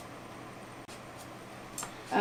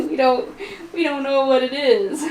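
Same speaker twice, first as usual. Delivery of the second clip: laughing; 3 semitones higher than usual. Real, not acted. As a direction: laugh through the words. Laughing at own ignorance.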